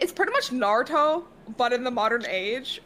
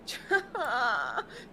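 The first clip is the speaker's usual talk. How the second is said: sheepish laugh; 4 dB quieter than usual. Real, not acted. Laughs at own generic answer.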